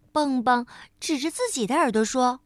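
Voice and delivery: ordinary delivery, nothing marked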